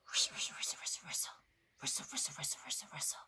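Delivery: quick, soft, repeated whisper; whispered rustling. Acted. Fox approaching through the wood, whispered rustle. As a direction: make the word sound like moving leaves.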